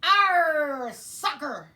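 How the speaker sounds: arr de coro, alto e agudo; tripulação gritando. Acted. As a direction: grite em coro como piratas. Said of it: "the crew yelled".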